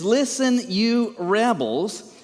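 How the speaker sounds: slow, furious scolding; livid. Shown as a demonstration. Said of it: "he is livid".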